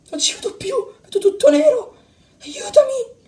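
high, helpless cry; frightened plea. Acted. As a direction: plead with the pitch climbing. Blinded, begs for help.

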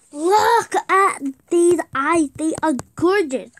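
slow excited admiring. Real, not acted. Admiring her drawing.

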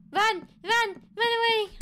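high shouted call to flee; shouting run. Acted. Gamer yelling at the fleeing girl to run away. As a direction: yell it after her, high and loud.

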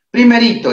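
Primerito called out high and loud; stressed first word. Shown as a demonstration. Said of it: "énfasis de profesora". (Primerito, agudo y fuerte)

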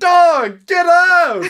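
high startled yell; scream into giggles. Real, not acted. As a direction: yell them out of the room. Friend suddenly appears in his room.

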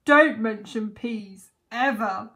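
loud, slow, pained command; groaning protest. A narrator's reading. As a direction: forbid it with heavy royal dismay. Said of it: "groaned the fat king".

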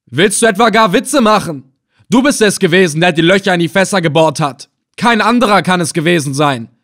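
high, loud, furious accusation; angry shouting. A narrator's reading. Captor accuses prisoner after kicking him, angry shouting. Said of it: "und schrie".

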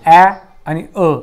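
ॲ as in "cat", then अ as "uh", slowly; loud, drawn-out ॲ, then अ; two vowel sounds. Shown as a demonstration. Teacher showing both sounds of A, ॲ and अ said slowly. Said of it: "दोन उच्चार केले जातात".

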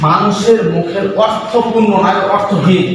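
slow dictation. Real, not acted. Dictating definition.

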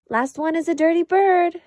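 racing his sister down the hall; playful shout. A narrator's reading. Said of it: "shouted Brother".

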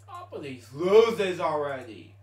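loud, slow and fed up; real annoyed shout at the screen; exasperated outburst. Real, not acted. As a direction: snap at the game to stop losing. Frustrated at repeated game losses.

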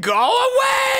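high, slow, drawn-out yell; yelling go away. Acted. Player yelling at a rival attacking near her base, drawn-out high shout. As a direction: stretch the shout out to shoo him off.

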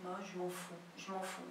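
"Moi, je m'en fous" dito bem mais baixo, indiferente; desdém baixinho. Retold or imitated. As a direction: dar de ombros em voz baixa. Cena de desdém, francês. (desprezo murmurado, bem mais baixo)

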